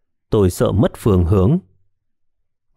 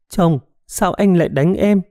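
plain reading voice

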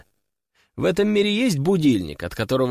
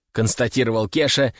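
usual speaking voice

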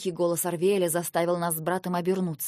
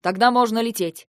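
eager; 7 dB louder than usual. Acted. Guard eager.

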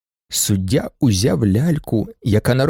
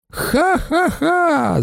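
high, drawn-out acted "ha-ha-ha"; scornful cackle; 14 semitones higher, much slower than usual. A narrator's reading. Baroness mocks the price, with a high acted cackle. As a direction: cackle slowly, pitched very high. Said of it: "зареготала баронеса".

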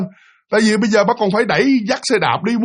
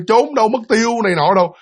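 normal talking voice

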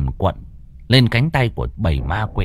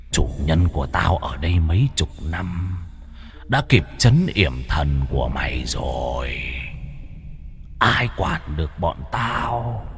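demon voice; 6 semitones lower, 6 dB quieter than usual. A narrator's reading. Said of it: "Con quỷ cầm đầu lên tiếng".

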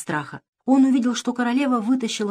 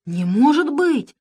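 high, slow mock outrage; queen faking anger to fool Pashka, high and slow; feigned anger; 8 semitones higher, 5 dB louder, slower than usual. A narrator's reading. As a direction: fake being shocked and cross. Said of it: "притворно рассердилась королева".

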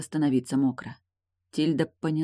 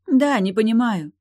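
loud, high angry retort; heated, louder comeback; raised voice; 7 semitones higher, 8 dB louder than usual. A narrator's reading. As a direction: snap back with raised voice. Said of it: "Эйлин повысила тон".

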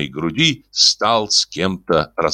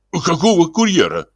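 horrified; 10 semitones higher than usual. Acted. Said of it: "ужаснулся Издурёнков".

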